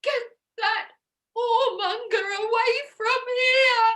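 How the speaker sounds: very high, tearful shriek; hysterical wail. Acted. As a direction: shriek it through acted sobs. Distraught mother, told to calm down.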